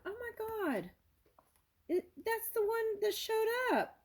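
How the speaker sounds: very high-pitched, overwhelmed exclamations; real tripping-out amazement. Real, not acted. Reader startled that the goddess she drew showed up, tripping out. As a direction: squeal it in real astonishment. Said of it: "I'm tripping out".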